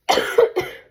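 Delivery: sudden dry cough. Real, not acted. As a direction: cough once, hard. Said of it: "and I might cough".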